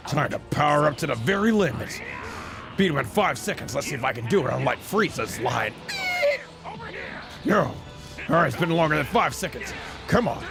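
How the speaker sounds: lightly voiced cocky fighter, chuckling between remarks; lightly put-on hero voice; in-character anime hero. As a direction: play the Super Saiyan hero enjoying his own moves. Plays the game speaking as its fighter.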